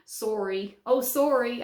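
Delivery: imitated Canadian "sorry" with a rounded "or"; Canadian accent. Imitating the Canadian attorney she works for, Canadian accent. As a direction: say "sorry" as "SORE-ee", Canadian style. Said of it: "he still has that accent".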